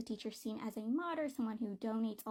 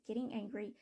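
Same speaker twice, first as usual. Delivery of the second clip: ordinary delivery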